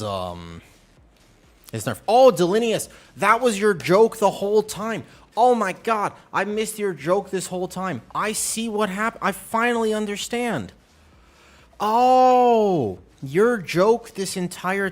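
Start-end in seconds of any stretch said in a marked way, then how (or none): 2.1-10.7 louder and higher, suddenly getting the joke; excited realization. Real, not acted. Streamer realizing the chat's long joke, loud and excited. 11.8-13.0 surprised oh. Real, not acted. Realization exclamation.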